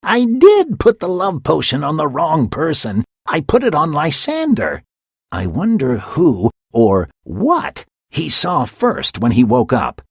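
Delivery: high, loud impish glee; gleeful Puck. Acted. Puck confessing his mistake to the audience, gleeful. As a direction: mischief dawning.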